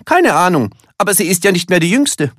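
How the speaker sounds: high, loud character voice; animated storybook elephant, pitched well up; lively cousin. A narrator's reading. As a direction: toss back a quick, high-pitched answer. Willi answers Elmar in story.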